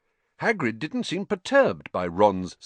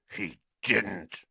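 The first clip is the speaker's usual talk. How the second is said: growl. A narrator's reading. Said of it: "he growled at Hermione".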